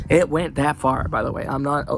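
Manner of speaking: ordinary talk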